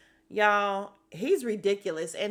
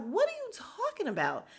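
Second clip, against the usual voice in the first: high, indignant disbelief; indignant retort; 13 semitones higher than usual. Retold or imitated. Scene: wife rounds on her husband, high indignant disbelief. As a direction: Drew snaps back in disbelief, pitched way up.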